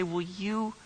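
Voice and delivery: soft, halting shushing; hushed, slow reprimand, word by word; hushed telling-off. Acted. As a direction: shush someone under your breath. Crowd shushing the screaming man.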